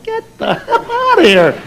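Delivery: high, drawn-out incredulous dismissal; mock outrage. Husband rejecting wife's ethnic baby name, mock outrage. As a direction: scoff at it in a high, slow cry.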